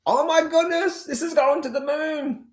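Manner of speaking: greedy trader, over the top with joy; mock euphoria. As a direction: squeal like a trader who thinks it's going to the moon. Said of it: "in that emotional state".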